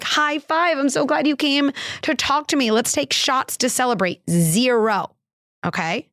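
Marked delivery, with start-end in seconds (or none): 0.0-4.2 high, gushing cheer of an overly lax parent; mock permissive parent. Parent mocking a lax response to teen drinking, high and gushing. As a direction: cheer it up like a parent high-fiving bad behaviour. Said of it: "I'm not gonna be like".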